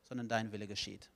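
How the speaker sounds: low, soft voice of God giving in; God conceding. Acted. God answers the self-willed person at the end of life, quietly conceding. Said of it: "dann wird Gott am Ende sagen".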